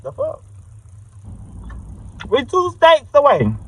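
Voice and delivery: high, sassy disbelief; indignant rant. Real, not acted. Ranting back at the lady on the phone, high and indignant.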